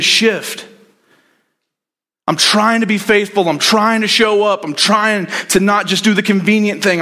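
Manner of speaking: high, strained plea to God, pitch far up and slowing on the questions; weary pleading David. Retold or imitated. Preacher voicing exhausted David begging God why. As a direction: cry out to God like a man worn out from running. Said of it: "David is reaching capacity of running".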